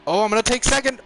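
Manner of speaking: high, loud burst of delight; thrilled cry at the pass; excited. Real, not acted. Racer grabbing second place in the race, thrilled shout.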